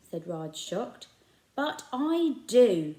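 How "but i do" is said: put-on slow, louder defiant boy; boy defying the shopkeeper about his father; fiery. A narrator's reading. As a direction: insist with burning anger. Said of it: "with fire in his eyes".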